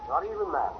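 "Not even that" dropped low and quiet; a hushed, sober aside; soft echo. Acted. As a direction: let it fall away under the breath.

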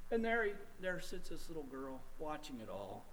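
soft, sorrowful drop in volume; sudden hush. Real, not acted. Preacher describing a little girl watching, hushed. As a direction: pull right back to a quiet ache.

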